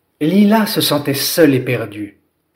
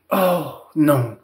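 a touch of sorrow; saddened. Acted. Lila hearing the forest is ruined, mildly sad. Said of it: "s'attrista Lila".

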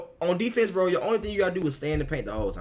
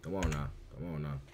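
low, soft disappointed "come on now"; quiet scolding; 11 semitones lower, 15 dB quieter than usual. Real, not acted. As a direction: mutter it, disappointed.

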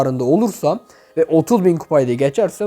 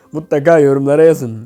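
laughing; 3 semitones lower, 7 dB louder than usual. Real, not acted. Laughs, asks for comments.

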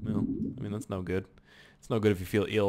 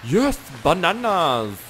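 loud, high cheer; jubilant, high-pitched holler; excited yell; 7 semitones higher, 8 dB louder, slower than usual. Real, not acted. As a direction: shout it in triumph. Delighted at spawning food.

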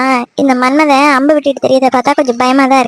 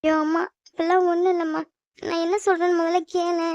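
much softer begging; soft pleading; 3 semitones higher, 12 dB quieter than usual. Acted. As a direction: beg to be heard, meekly. Daughter pleads with mother.

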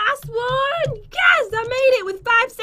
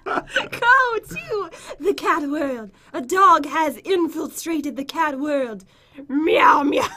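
princess voice; 7 semitones lower, slower than usual. Pretend. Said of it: "can you do the princess voice?".

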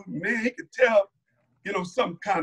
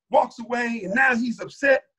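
ordinary talk, nothing marked

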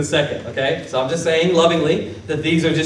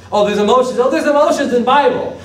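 mock discovery; 6 semitones higher than usual. Mimics someone's light discovery.

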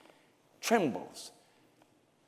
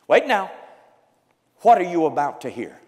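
ordinary delivery

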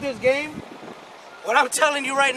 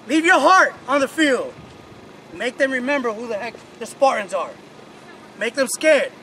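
pep speech; 2 semitones higher than usual. Real, not acted. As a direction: fire them up. Coach firing up team.

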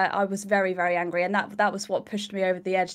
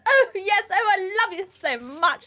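high, slow gushing fake cheer; overly sweet sarcasm; 6 semitones higher, slower than usual. Shop worker gushing at a rude customer, mock sweet. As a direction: pile on the fake enthusiasm. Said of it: "I'm just like".

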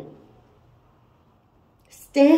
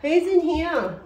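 loud, higher call into the room; raised, projected question; 8 semitones higher, 8 dB louder, slower than usual. Real, not acted. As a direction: call out to whoever is hiding. Calling out to spirits.

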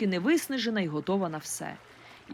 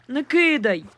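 pleading louder; 5 semitones higher, 6 dB louder, slower than usual. A narrator's reading. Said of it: "попросив він".

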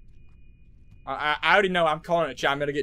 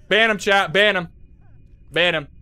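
loud order; 7 dB louder than usual. Real, not acted. Ordering chat to ban someone.

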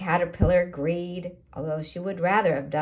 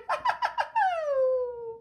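high-pitched caterpillar giggle; a gleeful little laugh. Acted. Caterpillar laughs after song.

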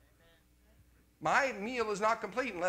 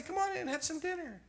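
higher, light voice of a kind ex-wife; ex-wife's invitation; 13 semitones higher than usual. Retold or imitated. Ex-wife welcoming him in for dinner, high friendly voice. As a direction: lightly voice a hospitable woman. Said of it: "She would say".

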